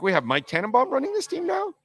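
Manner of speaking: high incredulous rhetorical question; sarcastic question. Real, not acted. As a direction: scoff at the idea.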